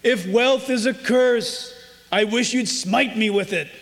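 slow, lightly acted appeal to heaven; a measured mock plea to God; imitating Tevye. Preacher quotes Tevye asking God for wealth, lightly imitated. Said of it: "He goes like this".

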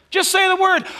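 high, loud cry of faith; acted centurion's shouted plea; fervent plea. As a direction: call it out boldly and urgently.

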